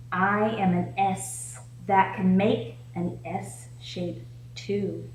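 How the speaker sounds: ordinary talk, nothing marked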